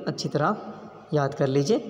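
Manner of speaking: ordinary talk, nothing marked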